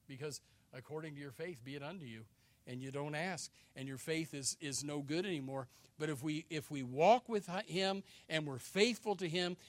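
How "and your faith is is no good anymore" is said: ordinary delivery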